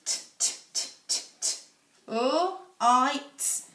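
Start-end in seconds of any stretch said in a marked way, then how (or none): 0.0-1.6 t as a crisp unvoiced tap, repeated; phonics sound t. Shown as a demonstration. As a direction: tap out t, t, t with no vowel after it. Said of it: "the last sound".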